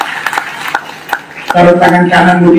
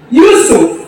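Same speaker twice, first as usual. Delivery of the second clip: usual speaking voice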